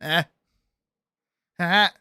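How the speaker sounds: normal talking voice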